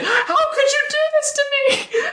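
high, hammed-up sobbing wail; mock crying wail. Podcaster mocking the heroine's betrayal, high mock-sobbing wail. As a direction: wail it like a melodramatic movie breakdown.

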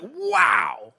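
high, bright, delighted wow; amazed wow. Shown as a demonstration. Preacher showing the congregation a clear wow, amazed. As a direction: sing out the wow in wonder. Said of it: "Wow is like".

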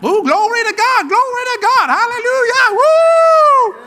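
high, loud ecstatic praise ending in a shouted woo; ecstatic Jesus. Retold or imitated. Jesus taking a lap around the tent, high ecstatic shouts. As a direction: whoop it up while running a lap. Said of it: "He probably took a lap".

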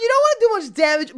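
fast, high, loud insistence; fast excited emphasis; emphatic. Real, not acted. Emphatic agreement, rising excitement.

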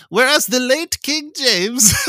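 loud, high-pitched mock Cockney; hammed-up Cockney, breaking into laughter; bad Cockney accent. Reading the Bill of Rights for laughs, bad Cockney accent. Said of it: "need to work on your Cockney accent".

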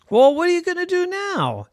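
high, loud mom voice; mother asking her boy, puzzled. Acted.

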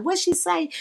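high, confused question; bewildered what'd she say; mock puzzled. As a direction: act like you missed it. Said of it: "at first I'm like".

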